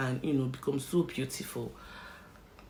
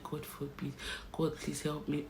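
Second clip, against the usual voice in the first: murmured prayer; 10 dB quieter than usual. Retold or imitated. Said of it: "and I kept crying".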